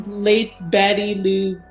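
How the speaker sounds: ordinary talk, nothing marked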